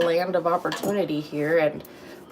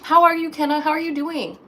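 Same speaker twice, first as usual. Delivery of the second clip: much higher, bright sing-song hello; excited greeting; 9 semitones higher, 4 dB louder than usual. Real, not acted. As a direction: gush over a friend. Greeting viewer.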